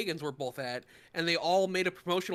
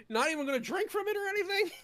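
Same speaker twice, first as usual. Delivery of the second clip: high-pitched, rushed mock question; mock disbelief; 13 semitones higher, faster than usual. Speaker reacting to Tails putting the cup back, mock disbelief. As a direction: squeak it out in disbelief, rising high.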